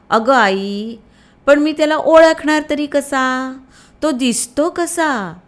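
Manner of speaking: put-on a little boy puzzling over how to recognise his brother; child's voice. A narrator's reading. Shyam sits up in bed and asks his mother, a boy's voice.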